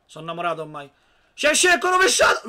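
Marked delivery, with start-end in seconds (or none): 1.3-2.5 high, loud burst of excitement; excited yell. Real, not acted. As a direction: shout it out in surprise. Reacting to overhead kick.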